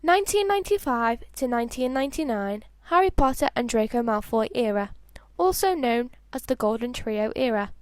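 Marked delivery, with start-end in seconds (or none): none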